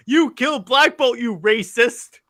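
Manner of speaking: mock-outraged accusation, high and loud; Deadpool. Fan quoting a meme where Deadpool accuses the killer, mock outrage. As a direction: shout the accusation, stretching out the last word. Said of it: "Deadpool goes".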